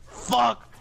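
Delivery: put-on mild Mexican accent on Fuck; a light Mexican accent imitation. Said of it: "in a funny Mexican accent".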